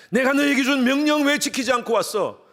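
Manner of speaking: God rebuking. Pretend. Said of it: "질책하실 것입니다".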